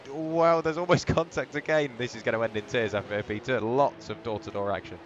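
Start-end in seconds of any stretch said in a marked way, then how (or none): 0.1-1.9 loud and high, breaking into a laugh at the near contact; real thrill as they almost collide; excited commentary. Real, not acted. Cars nearly touch again.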